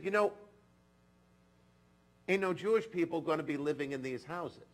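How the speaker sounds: a hint of a Texan foreman, pushed up and loud; attempted Texan accent. Quoting the church foreman's warning in a light Texan accent. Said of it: "I can't get the accent right".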